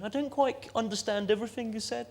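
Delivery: high-pitched mock Leamington Spa English accent. Comic plays a puzzled television viewer, Leamington Spa accent. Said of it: "a very convincing Leamington Spa accent".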